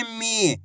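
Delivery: "मी" with the ई stretched; long drawn-out ī. Shown as a demonstration. Teacher giving learners an example, "मी" drawn out. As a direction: hold the vowel of "मी" long. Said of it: "बड़ी ई की मात्रा को खींच के पढ़ा जाता है".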